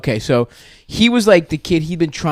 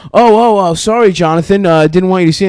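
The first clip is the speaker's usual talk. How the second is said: loud, high, flustered fake apology; hammed-up caught-in-the-act sorry; mock flustered guys covering up; caught teammate; 5 semitones higher, 6 dB louder than usual. Teammates caught, faking innocence.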